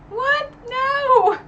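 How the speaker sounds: high, loud disbelieving cry; shocked outcry. Retold or imitated. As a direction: yell it out in outraged shock. Her outburst at the betrayal.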